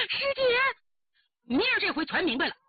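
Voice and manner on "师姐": very high exclamation to a sister; shocked cry. Acted. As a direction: cry out in disbelief. Shocked cry after being rebuked. (震惊地尖声叫出)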